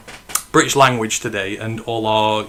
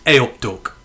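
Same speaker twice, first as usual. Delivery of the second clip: "A up, Duck" in a plain Midlands accent, level and easy; a relaxed Midlands accent; Midlands greeting. Shown as a demonstration. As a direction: say it the local Midlands way, calmly. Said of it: "We call each other Duck here".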